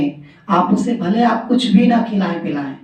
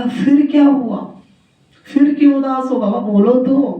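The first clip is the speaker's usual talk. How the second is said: high, insistent coaxing questions; a husband begging her to say it; pleading king; 6 semitones higher than usual. Acted. King Akbar pressing the weeping queen, high and pleading.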